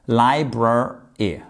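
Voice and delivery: "library" drawn out slowly, lai-brar, then y; slow, broken into syllables. Shown as a demonstration. Teacher having learners repeat a tricky word, slow syllable by syllable. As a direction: split "library" into slow separate pieces. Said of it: "On répète ensemble".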